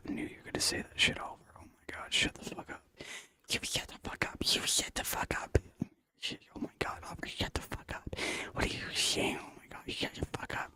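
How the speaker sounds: voiceless whisper throughout. Real, not acted. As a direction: keep it all under your breath. Whispering while streaming/gaming.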